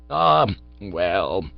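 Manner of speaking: slow, drawn-out "Ah, well" with a long sighing "Ah"; resigned old man lingering over "Ah". A narrator's reading. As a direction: let the "Ah" hang wistfully before "well". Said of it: "he said again".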